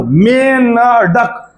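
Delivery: मेन डक said very slowly, the syllables pulled apart; teacher stretching मेन डक to show the nasal sound; split syllables. Shown as a demonstration. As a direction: break मेंढक into मेन and डक slowly. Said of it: "मे के बाद बिंदी की ध्वनि".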